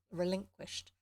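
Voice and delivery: plain reading voice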